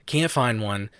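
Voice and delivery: normal talking voice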